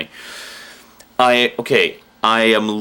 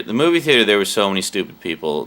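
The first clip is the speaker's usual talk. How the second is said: ordinary talk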